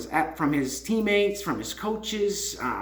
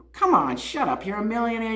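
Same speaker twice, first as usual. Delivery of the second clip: usual speaking voice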